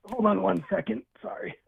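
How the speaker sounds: speaking away from the phone; turning away from phone. Real, not acted. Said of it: "sorry, that was my kid".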